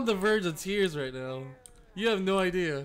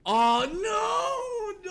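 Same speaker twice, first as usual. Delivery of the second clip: much higher mock cry; mock dismay; 14 semitones higher, 3 dB louder than usual. Streamer mocking a survivor to chat, hammed-up cry. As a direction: ham up the despair.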